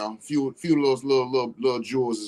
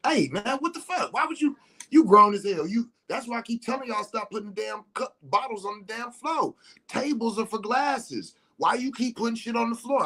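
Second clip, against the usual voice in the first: higher-pitched mock chewing-out; lightly mock scolding; 11 semitones higher than usual. Scolding adult friends about cups on the floor, mock scolding. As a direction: chew out the grown men, half in fun. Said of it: "I'm chopping to adults like that".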